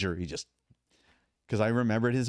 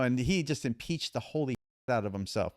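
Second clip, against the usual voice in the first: ordinary talk, nothing marked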